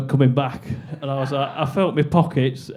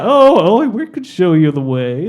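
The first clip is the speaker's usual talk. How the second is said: put-on American girl; 2 semitones higher, 5 dB louder than usual. Yankee girl offering directions to lost tourists, in an American accent. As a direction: do an eager Yankee girl's accent. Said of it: "these two Yankee girls were like".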